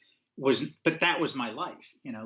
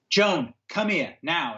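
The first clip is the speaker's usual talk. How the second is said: stern summons; 6 semitones higher, slower than usual. Retold or imitated. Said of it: "he says to Joan".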